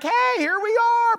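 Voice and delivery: high, squeaky little call of a lost coin. Pretend. Pastor voicing lost coins calling out to their owner, high squeaky call. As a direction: pipe up like tiny coins waving for attention.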